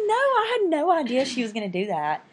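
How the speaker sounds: high, loud, giggly denial; laughing protest. Retold or imitated. Answering her fiance's teasing, loud laughing protest. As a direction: defend yourself while laughing. Denying fiance's con-artist tease.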